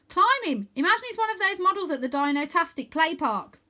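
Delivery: put-on girl urges boy onto the dragon; girl urging. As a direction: push him to go now. Rose advising.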